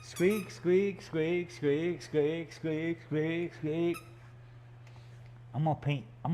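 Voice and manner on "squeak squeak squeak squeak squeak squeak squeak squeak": repeated squeaks in a slightly raised voice; mock squeaking. Artist teasing the dog over a squeaky toy, mimicked squeaks. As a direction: squeak along in an even singsong.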